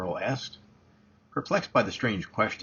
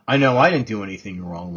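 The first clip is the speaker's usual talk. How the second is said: a hint of sharp indignation, louder; shot back; 9 dB louder than usual. A narrator's reading. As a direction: fire it back, mildly defensive. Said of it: "Lauren shot".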